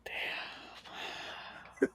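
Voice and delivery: breathless whisper of shock; whispered damn. Real, not acted. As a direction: barely breathe it, stunned. Reaction to crude joke.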